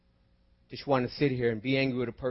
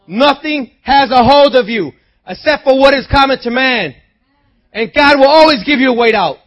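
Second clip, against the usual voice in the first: loud, high, driving sermon delivery; full-force preaching; 11 semitones higher, 14 dB louder than usual. Real, not acted. Preacher promising believers God's way out, full-force exhortation. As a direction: proclaim it to the whole church at full voice.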